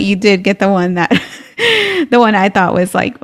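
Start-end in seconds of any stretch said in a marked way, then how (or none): 1.6-2.1 high-pitched burst of laughter; short laugh. Real, not acted. Host on the cover she preferred, high laugh. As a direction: break off into a quick high laugh.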